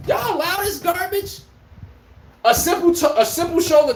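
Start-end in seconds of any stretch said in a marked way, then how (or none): 0.1-1.4 much higher, slow cry of disbelief; high, drawn-out outraged disbelief; incredulous outburst. Retold or imitated. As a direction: throw your voice way up, stunned at the refs. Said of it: "I'm like".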